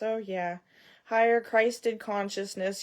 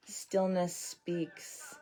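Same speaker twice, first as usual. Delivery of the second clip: quiet mystic; 7 dB quieter, much slower than usual. Pretend. Mystic aside after stillness talk.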